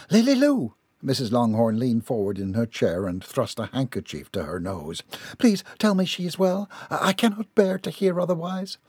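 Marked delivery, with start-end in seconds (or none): 0.1-0.7 high-pitched cry of her daughter's name; distraught cry. A narrator's reading. Mother cries daughter's name at news. 5.4-8.8 high-pitched, pleading mother voice; anxious pleading. A narrator's reading.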